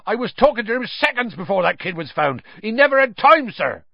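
high, agitated character voice; urgent Hagrid. A narrator's reading. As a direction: plead the case, rising to a loud peak. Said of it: "said Hagrid urgently".

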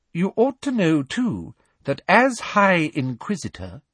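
high, prim, sugary menace; Umbridge's cold threat. A narrator's reading. Umbridge warning Hagrid of inspection, high prim menace. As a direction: smile while threatening him. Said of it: "She turned sharply and marched".

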